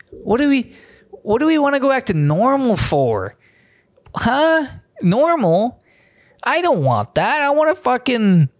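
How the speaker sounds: high, exaggerated chirpy sarcasm; sarcastic mock enthusiasm. Vlogger mocking a headline, sarcastic high voice. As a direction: gush about never going back, dripping sarcasm.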